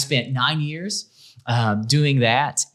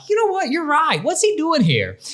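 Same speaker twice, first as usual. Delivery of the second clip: a high, hammed-up impression of the boss; the boss joining the coworker, done in a high mock voice. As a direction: pitch way up, playing the boss agreeing. Boss chiming in, retold scene.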